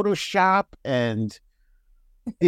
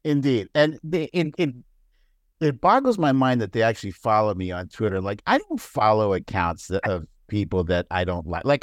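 ordinary delivery

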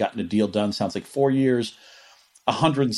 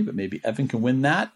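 ordinary talk, nothing marked

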